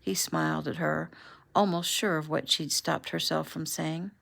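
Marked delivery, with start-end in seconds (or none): none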